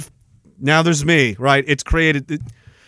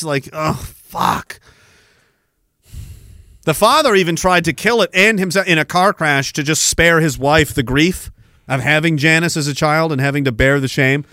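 ordinary delivery

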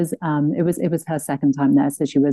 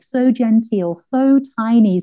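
usual speaking voice

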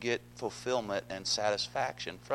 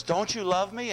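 higher, pleading whine; whiny Delilah; 8 semitones higher, 5 dB louder than usual. Acted. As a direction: whine it like a sulking lover. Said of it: "she was a whiner".

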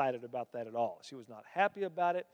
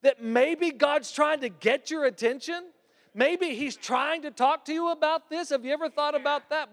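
higher, urging her mother to see it; earnest daughter; 10 semitones higher, 3 dB louder than usual. Retold or imitated. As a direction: press her mom gently but urgently. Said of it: "She said".

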